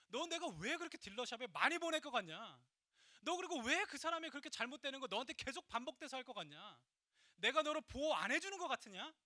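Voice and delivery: loud, high, forceful questioning as God; God challenging him. Retold or imitated. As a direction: press a servant with pointed questions, voicing God. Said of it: "근데 주님께서요". (주님이 다그치듯 힘차게)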